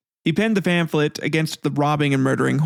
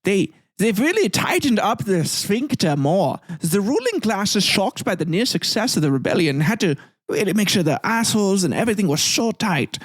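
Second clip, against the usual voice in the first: mock German commentator; 5 semitones higher than usual. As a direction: explain it in a hammed-up German accent. Comic put-on voice retelling history.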